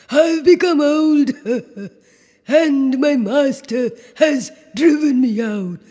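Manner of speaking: high donkey voice with braying laughs; a woeful old donkey laughing sadly. Acted. Old donkey tells sad story.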